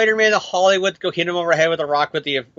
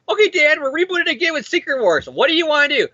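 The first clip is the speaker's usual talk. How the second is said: mock editor; 8 semitones higher than usual. Editor voiced in mock dialogue.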